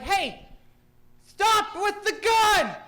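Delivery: high, loud, drawn-out cop command; mock police shout. Bicycle cop to an armed suspect, mock shouting. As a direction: yell it like a cop halting a suspect.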